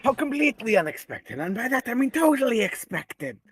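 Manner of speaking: sarcastic cartoon villain greeting his nemesis; Doofenshmirtz impression. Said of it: "sound like Doofenshmirtz".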